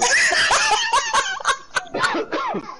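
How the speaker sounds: high, loud helpless laughter; loud laughing fit. Real, not acted. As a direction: burst out laughing, can't stop.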